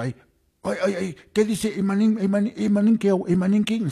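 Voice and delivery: a teacher who can't believe his ears; astonished teacher. Retold or imitated. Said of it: "huk profesor uyaramusqa".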